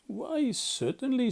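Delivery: a friendly, generous yes; good-natured. Acted. Rat happily agreeing to take Mole visiting, slow and high. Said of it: "said the good-natured Rat".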